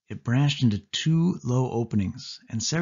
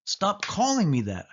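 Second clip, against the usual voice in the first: higher, irritated insistence; insisting protest; 10 semitones higher than usual. A narrator's reading. The cousin insists Sari stop teasing him, irritated. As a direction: push back, fed up. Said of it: "I insisted".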